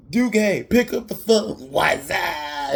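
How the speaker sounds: high, loud mock yell; imitated shouting. Friend hollering to pick up the phone, mock shouting. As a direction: yell it out like a goofy movie catchphrase.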